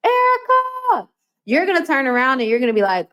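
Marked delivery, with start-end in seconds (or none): 0.0-1.1 high, drawn-out call across an airport; calling out a name. Retold or imitated. Stranger calling out Erica across an airport. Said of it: "you hear somebody yell".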